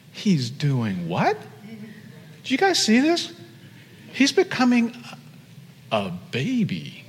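hushed high-pitched disbelief from an onlooking angel; wondering angel. Acted. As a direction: gawk in wonder, drawing the words out. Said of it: "like you're in wonder, you're intrigued".

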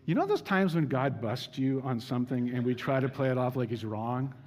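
ordinary talk, nothing marked

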